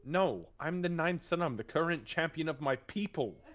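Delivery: proud correction. Acted. Marcus corrects his title, high proud emphasis. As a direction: correct him grandly and proudly.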